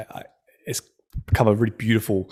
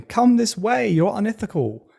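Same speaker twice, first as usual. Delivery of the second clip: high-pitched imitation of calling out; mock shouting; 9 semitones higher than usual. Shouting from a little speedboat at a tanker, mock shout. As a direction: call out high, without real volume. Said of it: "on a little speedboat shouting".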